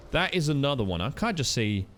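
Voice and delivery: normal talking voice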